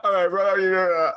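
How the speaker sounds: high, drawn-out Croydon greeting; full Croydon British. Retold or imitated. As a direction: put on an over-the-top Croydon accent, pitched right up. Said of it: "You've gone full British, full Croydon".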